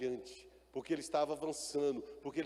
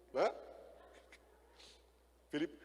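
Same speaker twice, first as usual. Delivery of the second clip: ordinary delivery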